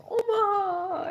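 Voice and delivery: high, wailing mock sob; imitated crying. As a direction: imitate a tearful cry for mother. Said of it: "they're all hugging and crying and going".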